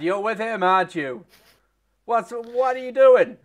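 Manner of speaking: high, loud, frightened; panicked. Acted. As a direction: panic when you see him. NPC panics seeing captor.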